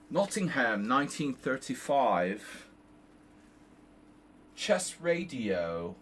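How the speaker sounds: drawn out like a station ID on the air; radio announcer voice. Pretend. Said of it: "I could do a podcast".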